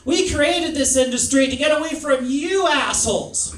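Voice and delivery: high, loud, hostile rejection; hammed-up furious group rebuke; loud mock outrage. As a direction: tell him off with full-volume scorn. Women angrily rejecting him.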